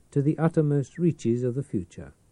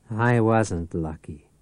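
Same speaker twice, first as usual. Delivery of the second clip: leering; 4 dB louder, slower than usual. Acted. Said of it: "the Peeper leered".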